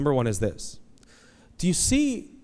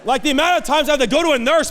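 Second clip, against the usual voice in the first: high, loud preacher in full flow; a preacher pouring out his Kaiser frustration; impassioned preaching; 11 semitones higher, 7 dB louder than usual. Acted. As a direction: drive the point home from the pulpit.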